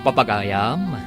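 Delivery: ordinary delivery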